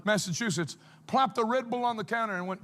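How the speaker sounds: ordinary talk, nothing marked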